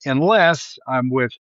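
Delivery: ordinary delivery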